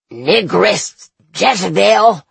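slow, wheezing dying preacher; wheezy voice. A narrator's reading. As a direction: wheeze the words out slowly. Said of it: "comes a wheezing voice".

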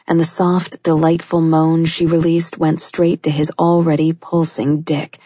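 ordinary delivery

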